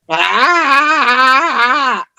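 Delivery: long, high-pitched "aah"; hammed-up cry of struggling to reach; mock straining cry. Acts out short-armed T-Rex straining.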